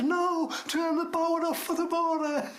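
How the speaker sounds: high, insistent correcting shout; retold shouting. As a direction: holler it back to correct a mishearing. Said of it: "I'm shouting".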